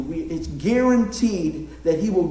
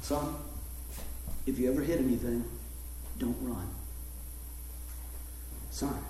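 low, quiet, grave father on the phone; stern dad; 10 semitones lower, 11 dB quieter, faster than usual. Retold or imitated. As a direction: talk low and serious like a dad laying down the rules. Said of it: "My dad calls home from work".

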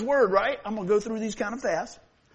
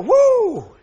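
high, loud joyful whoop; excited whoop; 14 dB louder than usual. Real, not acted. Preacher cheering the dog home, loud high whoop.

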